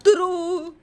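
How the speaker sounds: high, sustained sing-out answer; drawn-out verdict. Pretend. Answering a personality quiz, high and drawn-out. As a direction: stretch the vowel on one held note.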